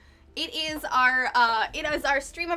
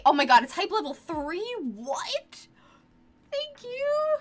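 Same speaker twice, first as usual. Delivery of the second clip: surprised; 7 semitones higher, slower than usual. Real, not acted. Hype level three reached.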